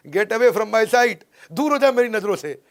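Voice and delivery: loud, high dramatic dismissal; hammed-up movie outburst; angry film line. Acts angry film line and translation.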